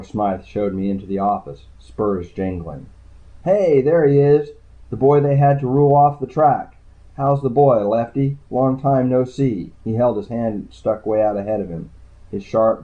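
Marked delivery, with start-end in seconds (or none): 3.4-9.7 loud, high, back-slapping welcome; Pino's big fake-friendly hello, louder and higher; hearty casino boss greeting. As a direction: boom it out like a fake old pal. Pino greets Lefty with false cheer.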